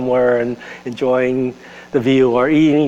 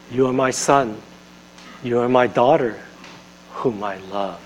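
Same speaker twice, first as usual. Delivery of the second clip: tender whisper of God; 5 semitones lower, 5 dB quieter than usual. Acted. God's tender words voiced softly.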